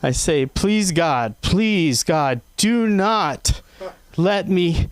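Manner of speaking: ordinary delivery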